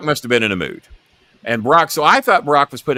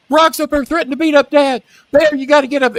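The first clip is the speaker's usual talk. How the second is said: put-on panicked kid; 5 dB louder than usual. Teen boy runs for help.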